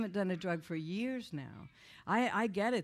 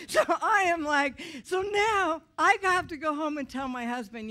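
loud, raised exasperation; heated telling; 7 semitones higher, 8 dB louder than usual. Real, not acted. Dreading telling her husband, loud and worked up. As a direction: push it out with frustration.

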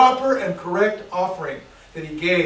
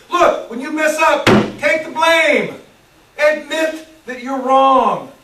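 higher, louder, slow preaching command; forceful exhortation; 6 semitones higher, 8 dB louder, slower than usual. Real, not acted. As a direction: hammer the point home to the church.

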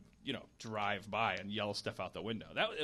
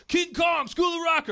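loud, high mocking yell of titles; yelling fan; 12 dB louder than usual. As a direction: heckle him with his movie titles. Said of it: "they come up later and go like".

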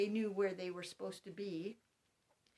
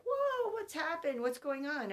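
put-on much higher, louder startled child's cry; the kid gasping at an emptied room; imitating a shocked child, high and loud; surprised child; 6 semitones higher, 5 dB louder than usual. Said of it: "And they were like".

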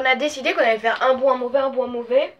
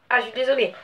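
ordinary delivery

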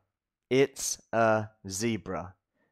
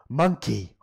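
ordinary delivery

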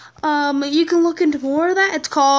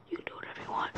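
soft whispered aside; whispering; 19 dB quieter, much faster than usual. Real, not acted. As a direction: whisper it offhand. Whispered aside after listing.

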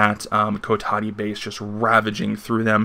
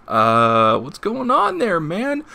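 much higher put-on voice, a casual surprised question; friends' surprised voice; 8 semitones higher, 3 dB louder, slower than usual. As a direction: pop your voice up high like a startled buddy. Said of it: "They're like".

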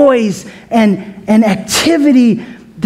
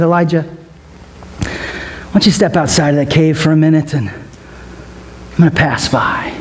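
gentle God voice; 6 semitones lower, 3 dB quieter than usual. Retold or imitated.

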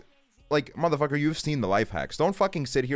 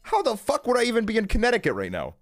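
incredulous; 12 semitones higher than usual. Real, not acted. Incredulous about Connecticut sighting.

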